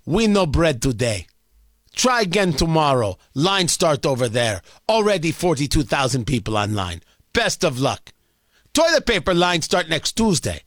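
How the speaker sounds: lightly done Russian bread clerk; old-timey Russian accent. Retold or imitated. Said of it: "is my old-timey Russian accent".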